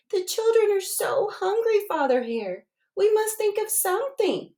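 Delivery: high, urgent mother hare voice; Miss Hare crying out. A narrator's reading. Miss Hare begging her husband, high and urgent. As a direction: plead like a worried mother. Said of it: "Miss Hare cried one day".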